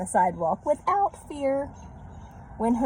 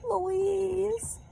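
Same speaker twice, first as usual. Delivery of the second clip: crying out; 11 semitones higher, much slower than usual. A narrator's reading. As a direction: call out for them, tearful. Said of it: "she cried".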